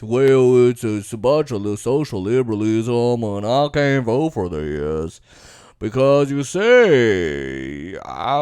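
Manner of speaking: low, drawn-out put-on voice; Josh Hawley. Senator explains opposing the bill, ponderous impression. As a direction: stretch the words out slow and low. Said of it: "I went over Josh Hawley and how he was against this".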